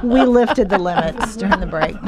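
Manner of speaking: giggling, barely holding it together; cracking up on air after the break; laughing. Real, not acted.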